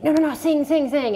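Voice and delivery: pleading. Retold or imitated. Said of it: "And he's like".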